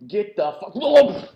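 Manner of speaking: slowed, stretched guard shout mid-fall; tripped guard yelling. Acted. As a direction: cry out as your feet are swept. GM voices guard being tripped.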